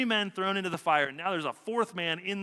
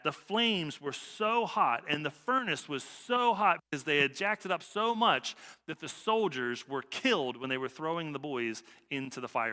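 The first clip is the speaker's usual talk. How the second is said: ordinary talk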